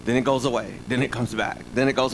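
ordinary talk

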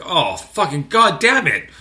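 loud, slow exasperated groan of a curse; frustrated outburst. Retold or imitated.

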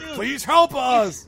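slow, high, loud mock plea; a mock-desperate cry for help; hammed-up plea. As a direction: plead for help, drawn out and overplayed. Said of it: "actually you were like".

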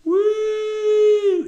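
high, sustained "woo"; real exultant holler; joyful whoop. Real, not acted. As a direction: let out a long high whoop. Preacher whoops over joy.

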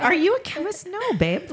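sassy retort to her husband; mock-indignant retort. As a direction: brush off the husband's caution.

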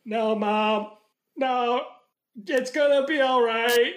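stiff, badly acted line readout; bad delivery. Mocking Hayden's wooden line to his dying mom, stiff. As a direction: say it like a wooden actor. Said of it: "like, a bad delivery".

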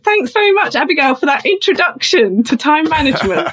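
high, bright presenter thanking a speaker; imitated mentor as host. Mentor takes over stage after applause.

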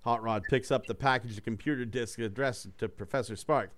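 normal talking voice

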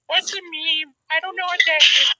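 doing Carl for friends, high put-on voice. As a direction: go way up into the character's voice. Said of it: "do the Carl impression".

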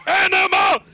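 high, very loud monster-puppet shout; Animal. Acted. Host performing next open-mic impression, wild loud shout. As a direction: yell your own name wildly. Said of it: "Next up, we have Animal".

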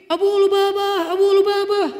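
high, loud call across the garden; calling a neighbour loudly. Acted. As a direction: shout his name from a distance.

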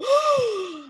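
sharp high mock gasp of shock; hammed-up shocked gasp. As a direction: gasp like a gullible viewer. Mocking gullible viewers' reaction.

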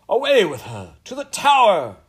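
loud, high royal command; imperious cry. A narrator's reading. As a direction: shout a furious order to the guards. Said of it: "cried the Queen".

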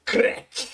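"crack" seco, sem voz, imitando caixa; estalo imitado. Retold or imitated. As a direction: imite o barulho das caixas. Imita som ao montar caixas. (estalo de caixa feito com a boca)

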